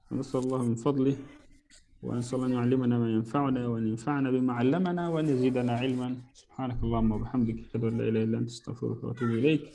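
fast, low-pitched recited supplication; closing prayer. Real, not acted. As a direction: run through a familiar closing du'a quickly. (دعاء ختامي سريع بصوت منخفض الطبقة)

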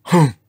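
grumpy "humph" snort. A narrator's reading. Old Triceratops snorts at the newcomer, snorting. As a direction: snort it out crossly. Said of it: "snorted George".

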